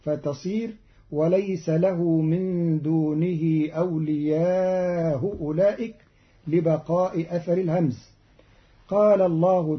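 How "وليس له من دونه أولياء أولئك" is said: أولياء بتسهيل الهمزة الأولى مع المد ببطء; سهّل همزة أولياء وامدد; تسهيل الهمزة. Shown as a demonstration. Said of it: "بتسهيل الهمزة الأولى مع المد". (قراءة قالون بتسهيل الهمز)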